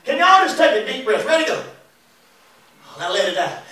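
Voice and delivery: usual speaking voice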